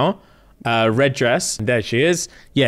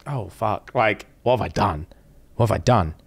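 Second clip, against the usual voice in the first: mock regret; 5 semitones lower, 3 dB quieter than usual. Acted regret after the kiss.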